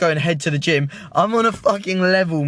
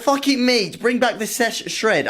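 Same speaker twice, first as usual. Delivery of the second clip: higher, slow and drawn out, rallying himself; talking himself into reviving his series, higher and slow; hyped-up inner voice; 7 semitones higher, slower than usual. Retold or imitated. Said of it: "So I thought".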